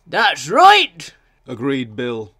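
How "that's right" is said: high, loud character voice; loud little engine piping up; agreeing little engine. A narrator's reading. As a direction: agree emphatically, pitched high.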